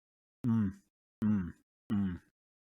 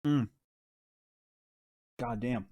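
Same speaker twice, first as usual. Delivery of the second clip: ordinary delivery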